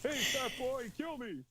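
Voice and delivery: put-on high old man voice; South Park grandpa. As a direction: beg like a cartoon grandpa. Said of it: "the grandpa voice from South Park".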